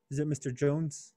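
ordinary talk

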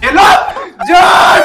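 sudden high, loud shout; one-word piercing call. Real, not acted. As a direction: yell the name out hard. Calls out suddenly during crate opening.

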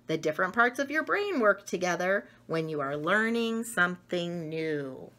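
ordinary delivery

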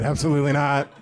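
big mock rejection; emphatic refusal. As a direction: refuse outright, hammed-up and loud. Acted bank refusal as punchline.

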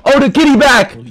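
high, delighted exclamation; excited surprise. Real, not acted. Player seeing the kitty come back, high excited cry. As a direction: burst out as it reappears. Cat reappears in game.

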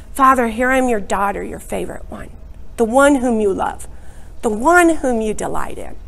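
quiet, intimate prayer to the Father; hushed devotional prayer; soft prayer. Acted. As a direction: pray it softly as a beloved daughter.

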